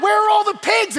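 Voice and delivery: high-pitched panicked shout; freaking out. Acted. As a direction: shout the question in alarm. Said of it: "They're freaking out".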